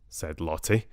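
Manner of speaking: plain reading voice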